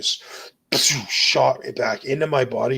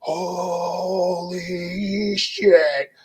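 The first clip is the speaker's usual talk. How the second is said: retold yell; 6 semitones higher, much slower than usual. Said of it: "like, loudest yell".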